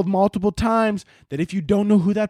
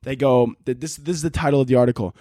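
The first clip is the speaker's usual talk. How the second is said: ordinary delivery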